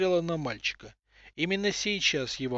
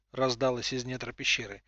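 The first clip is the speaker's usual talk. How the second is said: usual speaking voice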